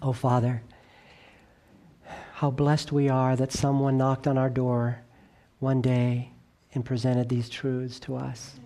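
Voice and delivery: soft, low reverent prayer voice; closing prayer. Real, not acted. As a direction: pray quietly to the Father.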